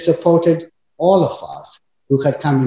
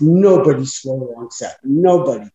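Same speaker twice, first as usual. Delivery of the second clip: usual speaking voice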